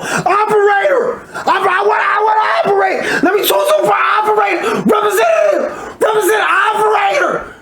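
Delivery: high, strained yelling into a phone; frantic shouting. Retold or imitated. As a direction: yell desperately for a human. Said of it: "all you gonna hear is me on the other side of the phone".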